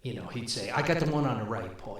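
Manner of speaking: normal talking voice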